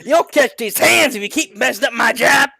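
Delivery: high, loud mock threat; hammed-up frustrated yell. A narrator's reading. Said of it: "said the man in frustration".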